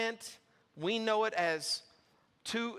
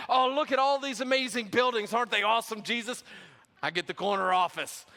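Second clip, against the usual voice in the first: higher and louder, gushing with delight; excited disciples; 3 semitones higher, 5 dB louder, faster than usual. Acted. Disciples admiring the temple buildings to Jesus, excited and loud. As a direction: play the starstruck disciple.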